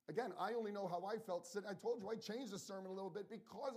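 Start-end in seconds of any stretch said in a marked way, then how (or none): none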